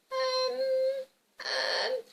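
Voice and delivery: high-pitched squirming hesitation; guilty little girl. Acted. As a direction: stall like a caught child. Girl caught by Grandma's question.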